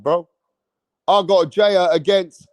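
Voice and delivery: ordinary talk, nothing marked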